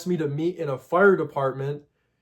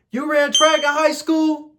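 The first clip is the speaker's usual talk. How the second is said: put-on a much higher kid voice, curious; 10 semitones higher, 4 dB louder than usual. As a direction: play the thirteen-year-old asking innocently. Kid asks weird question.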